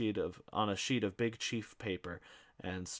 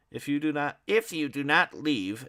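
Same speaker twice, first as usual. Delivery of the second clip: pompous threat; 6 semitones higher, 7 dB louder than usual. Acted. Ignatius threatening the policeman.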